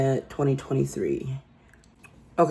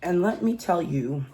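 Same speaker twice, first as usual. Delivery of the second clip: ordinary delivery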